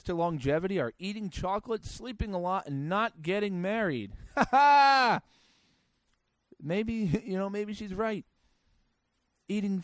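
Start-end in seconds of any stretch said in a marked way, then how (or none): 4.3-5.2 sharp high burst of laughter; a loud amused ha ha. Real, not acted. Laughs at the longevity secret.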